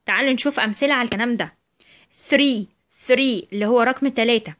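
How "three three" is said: "three" opening on voiceless th, said apart. Shown as a demonstration. Teacher gives examples of the rule, "three" with voiceless th. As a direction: model "three" with breathed th, not s. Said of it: "بتنطق في الحالة دي ث".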